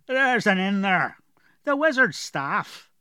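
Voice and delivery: slow, thick southern fisherman's dialect; gruff dwarf with a heavy rural accent; strong dwarf accent. Acted. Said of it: "Galoric had a very strong accent".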